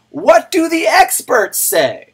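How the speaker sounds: high, loud, drawn-out mimicry; mocking shout. Streamer mimicking the question that set off his rant, mocking shout.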